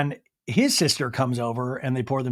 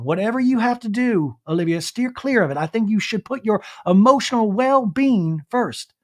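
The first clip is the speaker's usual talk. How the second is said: raised, put-on mom giving advice; Robin; 6 semitones higher than usual. Mom tells daughter to steer clear, mock motherly. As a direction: play the counselling mom, pitched up. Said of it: "with her mom, Robin".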